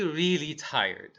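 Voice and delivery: normal talking voice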